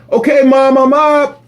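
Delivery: high, loud call to Mom; yelling back. Acted. Boy answering Mom's wake-up call, yelling back. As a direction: holler it to another room. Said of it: "I yell back".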